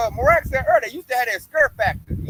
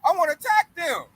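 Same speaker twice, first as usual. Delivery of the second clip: mock panic; 6 semitones higher than usual. Host voicing panicked Esau wanting to attack, high. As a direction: play the rattled bully. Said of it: "Esau looking like".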